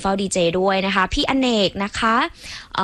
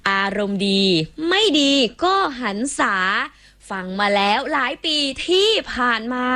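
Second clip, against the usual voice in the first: rhymed poem recital; 6 semitones higher than usual. Acted. DJ reads a listener's rhyming SMS as verse.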